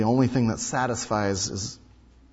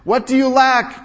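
high, loud street call; peddler's cry; 12 semitones higher, 8 dB louder than usual. Retold or imitated. Peddler hawking to the street crowd, calling out. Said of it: "cry out".

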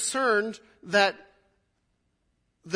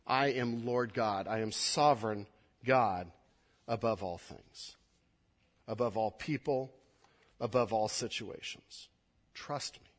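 gentle God voice; 6 semitones lower, 3 dB quieter than usual. Acted. Pastor voices God reassuring the people, soft and slowing. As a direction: reassure quietly, then whisper. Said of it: "he is saying".

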